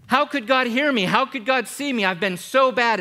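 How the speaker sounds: high, loud mock cry of doubt; imitating anxious doubter. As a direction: voice the worried sinner at full volume. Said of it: "many of you say".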